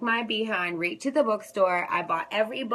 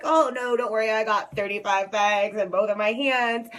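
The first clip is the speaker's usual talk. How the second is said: mock breezy refusal; 4 dB louder than usual. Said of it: "I'm like".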